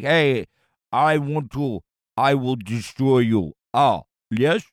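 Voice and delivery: mock Russian accent. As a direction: play a vodka-drunk Russian in a bad Russian accent. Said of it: "my Russian sounds like a caveman".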